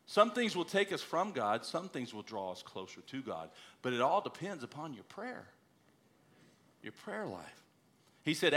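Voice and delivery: ordinary delivery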